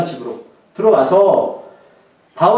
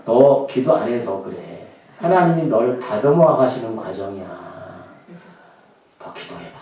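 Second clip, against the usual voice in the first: preachy know-it-all; 9 semitones lower, 5 dB quieter than usual. Retold or imitated. Believer lecturing a suffering friend, mildly preachy and lower. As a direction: lecture a struggling friend a bit smugly. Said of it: "너무 옳은 말만 합니다".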